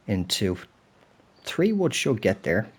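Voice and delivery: usual speaking voice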